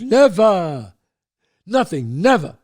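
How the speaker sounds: loud, punched-out denial; emphatic denial. Retold or imitated. Dad to young son about drugs, emphatic denial. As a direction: deny it loudly, each word set apart.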